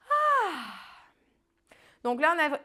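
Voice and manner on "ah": high "ah" sliding steeply down; real post-stretch sigh; sigh of release. Real, not acted. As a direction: let out a long falling sigh after stretching. Releases after the final stretch.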